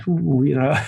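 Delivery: normal talking voice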